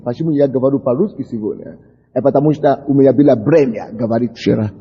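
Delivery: ordinary delivery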